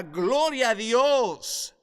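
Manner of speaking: high, loud, drawn-out praise; joyful shout. Real, not acted. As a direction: cry it out to the rafters. Estallido de alabanza. (el predicador grita de alegría a Dios)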